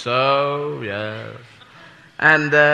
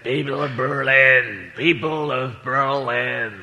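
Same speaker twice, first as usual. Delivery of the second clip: slow, lower declaiming speech voice; Kennedy impression; 5 semitones lower, 4 dB quieter, much slower than usual. Comic doing Kennedy at the Berlin Wall, slow lower declaiming. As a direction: orate it slowly like a famous speech. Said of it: "I can't do President Kennedy as an impression".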